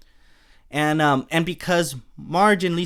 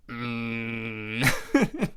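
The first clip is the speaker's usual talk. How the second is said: Marge moan; 4 semitones lower, 4 dB quieter than usual. Retold or imitated. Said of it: "Marge moans, that classic Marge".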